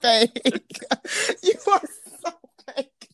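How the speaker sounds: laughing mock outrage, drawn out; playful laughing accusation; shrill overlapping reality fight. Real, not acted. Laughing tease about rejection joke.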